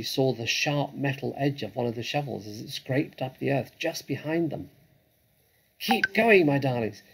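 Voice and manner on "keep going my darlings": breathless, urging his family on; panting. A narrator's reading. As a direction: pant the words out. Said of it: "panted Mister Fox".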